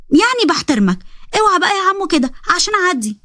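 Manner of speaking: put-on high childish pleading voice; little girl voice. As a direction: sound like an eager little girl asking her brother.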